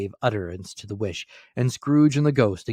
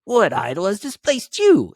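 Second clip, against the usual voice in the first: much higher and louder demand; young Scrooge challenges the girl, loud and high; sharp rejoinder; 12 semitones higher, 9 dB louder than usual. A narrator's reading. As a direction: fire the question back hard. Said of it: "he rejoined".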